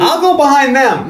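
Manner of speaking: mock detective line. Mocking detective in movie.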